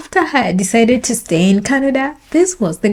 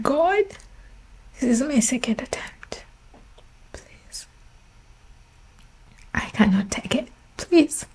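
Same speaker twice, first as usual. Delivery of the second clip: hushed, almost whispered plea to God; quiet desperate praying; pleading prayer; 7 dB quieter than usual. Retold or imitated. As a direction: beg softly, voice nearly gone.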